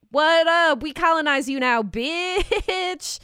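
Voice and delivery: sneering fake sweet, high and loud; Mean Girl voice. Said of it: "I like your Mean Girl voice about it".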